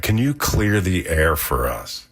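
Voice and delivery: usual speaking voice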